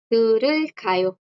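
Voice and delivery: "Turulkayo" stretched out slowly, syllable by syllable; teacher slowly models "Turulkayo" for the class; slow Korean demo. Shown as a demonstration. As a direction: spell out "Turulkayo" by ear, very slowly. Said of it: "Teta es el verbo escuchar".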